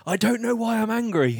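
high-pitched mock yell of a fan; a fan's half-comic outburst, yelled up high; retold angry shout. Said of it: "he shouted".

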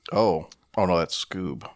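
ordinary talk

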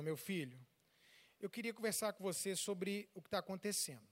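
soft, low motherly opening; mother calmly calling her son to talk; gentle mother. Retold or imitated. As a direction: ease into the talk tenderly.

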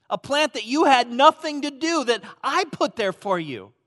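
loud, high preaching voice; full-force preaching. Acted. Pastor voicing God rebuking Jonah, loud and high. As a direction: press the point with rising fire.

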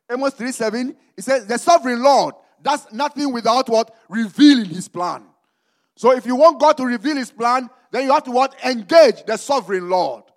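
loud, high preaching with long drawn-out words; slow emphatic proclamation, punching the key words. Real, not acted. Preacher proclaiming scripture to the church, forceful preaching.

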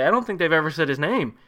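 ordinary talk, nothing marked

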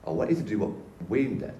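understated retelling of a divine instruction; Jesus speaking. Retold or imitated. Jesus calls the speaker to lead, quiet and measured. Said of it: "he says".

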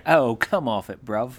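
mock London geezer, brushing it off; lightly put-on English accent, dismissive; a hint of cockney mockery. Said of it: "I'm gonna try not to do English accents".

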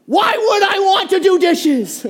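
high, loud, laughing mock outrage; incredulous husband. Husband baffled by his wife, laughing shout. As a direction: squeal it in disbelief. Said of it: "And he's like".

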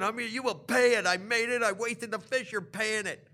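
high, loud, insistent shouting; retold screaming. Fish seller demanding payment from a customer, retold screaming. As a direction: yell it as an outraged vendor. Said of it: "But he's screaming".

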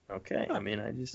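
deflated, fading; shrinking back into silence; trailing off quietly. Retold or imitated. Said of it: "And I was like".

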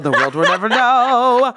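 slow, loud mock-mysterious line; dramatic narrator voice. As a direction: intone it like a trailer voice.

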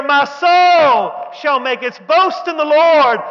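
high, slow shout of praise. Acted. Preacher urging the church to shout, exultant full-voice cry. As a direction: shout it out to the congregation, drawn out. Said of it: "Somebody should shout right there".

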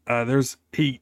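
normal talking voice

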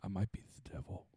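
low, fast mock-menacing whisper; whispered movie imitation. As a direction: whisper it like a film villain. Quoting the movie character's line.

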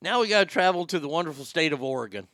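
usual speaking voice

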